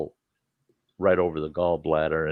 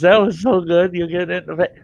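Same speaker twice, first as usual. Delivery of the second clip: laughing gal; 10 semitones higher, 6 dB louder than usual. Retold or imitated. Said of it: "She's laughing. She goes".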